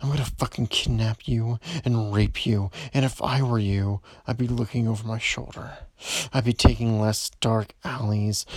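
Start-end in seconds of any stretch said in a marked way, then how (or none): none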